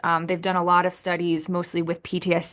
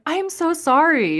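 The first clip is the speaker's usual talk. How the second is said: retold apology; 10 semitones higher, 3 dB louder than usual. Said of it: "I was like".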